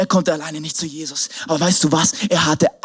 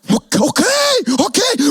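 mock toddler okay; 13 semitones higher, slower than usual. Toddler agrees, voiced.

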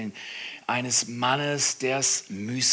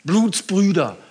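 loud, higher, urgent appeal; preaching at full force; 7 semitones higher, 9 dB louder than usual. Acted. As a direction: rouse the congregation. (laute, eindringliche Predigt)

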